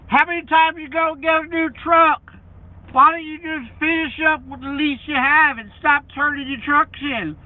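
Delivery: loud high nagging critic voice; mocking critic. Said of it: "quoting critics' complaints".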